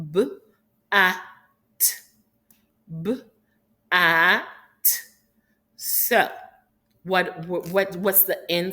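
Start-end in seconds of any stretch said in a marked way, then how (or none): 0.1-4.4 B-at split into b and at, punched; B-at said in two separate parts, louder; segmenting sounds. Shown as a demonstration. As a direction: tap out B-at sound by sound. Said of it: "make the sound on our finger".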